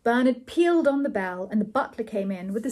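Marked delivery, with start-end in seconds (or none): none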